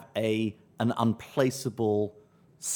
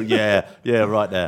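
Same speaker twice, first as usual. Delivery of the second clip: dropping back into South East London talk; South East London lad's reply; South East London accent; 2 semitones lower, 5 dB louder, slower than usual. Retold or imitated. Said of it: "in South East London".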